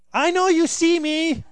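high, loud, drawn-out exasperation; exasperated mock yell at another car; acted driver's frustration. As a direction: call out indignantly at a driver ignoring you. Ignored driver trying to merge.

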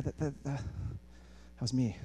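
very soft, slow, halting admission; understated reveal. Acted. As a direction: drop low and quiet, almost shyly owning it. God's understated punchline.

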